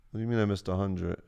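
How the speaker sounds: normal talking voice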